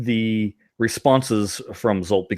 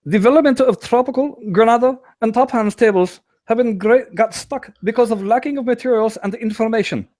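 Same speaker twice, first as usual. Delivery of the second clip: put-on much higher, overdone German accent; terrible German accent; 10 semitones higher than usual. Host voicing the developer's answers in a mock German accent. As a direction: ham up a bad German accent reading the answers. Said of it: "poor impersonations of a German person".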